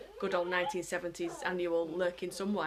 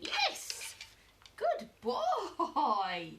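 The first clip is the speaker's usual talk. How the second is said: excited praise; 9 semitones higher, much slower than usual. Real, not acted. Owner praising her dog, squeaky and laughing. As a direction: burst out with delight.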